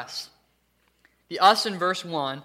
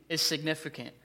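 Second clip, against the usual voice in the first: ordinary delivery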